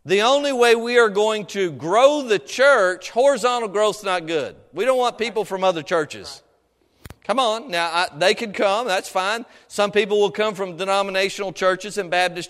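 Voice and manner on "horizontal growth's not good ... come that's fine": ordinary delivery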